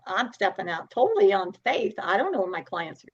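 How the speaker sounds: ordinary talk, nothing marked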